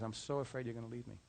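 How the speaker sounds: soft, hushed imitation of a crying man; mild hushed sob of a scared husband; retold tearful plea. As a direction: quietly voice his fearful tears, understated. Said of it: "he just started crying".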